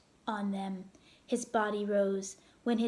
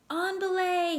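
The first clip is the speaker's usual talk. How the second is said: higher, louder climbing call; 8 semitones higher, 4 dB louder than usual. Acted. Father calling to his climbing son. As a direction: call it up the rock.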